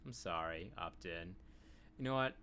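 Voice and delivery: ordinary talk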